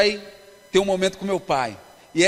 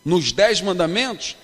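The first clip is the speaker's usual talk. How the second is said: fervent preaching; 5 dB louder than usual. Acted. Pastor preaching to a youth congress, fervent and emphatic. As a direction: preach it to the crowd, building.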